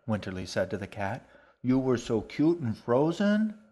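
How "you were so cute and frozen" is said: cooing to cat. A narrator's reading.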